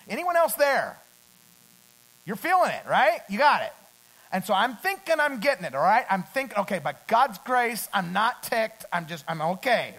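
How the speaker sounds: loud, high preacher calling to the crowd; rally the room, loud and ringing; full-force preaching. Acted. Preacher asking congregation if they feel it, loud rally.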